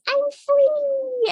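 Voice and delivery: very high squeaky cry of triumph; tiny high-pitched voice, drawn out; gleeful escaped creature. Retold or imitated.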